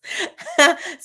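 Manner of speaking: a real high laugh breaking through; high burst of laughter. Real, not acted. Host cracking up at Kay's reports, high real laugh.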